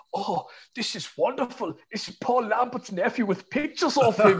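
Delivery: high-pitched mimicked wonder; thrilled fan. German fan shown a photo of Paul Lambert, mock delight. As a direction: imitate an awestruck German fan, pitched well up.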